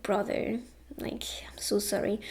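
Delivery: near-whispered, sorrowful apology; hushed apology. Retold or imitated. Speaking to a cut-down tree like a brother, hushed and sorry. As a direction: murmur it very softly, almost without voice.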